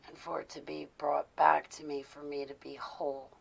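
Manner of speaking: hushed, intense prayer binding spirits; whispered prayer. Acted.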